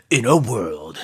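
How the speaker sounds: movie trailer voice. Acted. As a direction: boom it like an epic trailer. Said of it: "sound more like a movie trailer".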